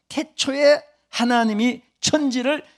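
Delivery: high, loud and slow, each word weighed; solemn scripture recitation. Acted. Pastor proclaiming Genesis to the congregation, slow and loud. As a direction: proclaim the verse like a preacher.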